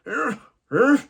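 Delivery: high, straining grandmother voice; imitated struggling Nana. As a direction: strain and grunt as a flustered Nana. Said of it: "She's like".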